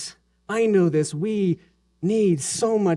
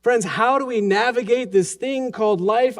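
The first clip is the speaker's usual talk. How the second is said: ordinary talk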